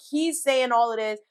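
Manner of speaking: ordinary talk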